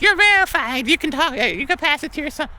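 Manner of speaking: high mock customer service voice; mock phone lady. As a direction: play the over-sweet call-center agent.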